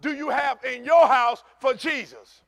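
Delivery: loud, high preaching at full force; shouting the sermon to the congregation. Acted. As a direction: preach it out to the church. Opening of the sermon.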